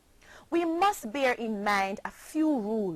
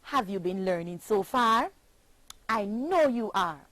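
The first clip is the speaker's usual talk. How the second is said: ordinary delivery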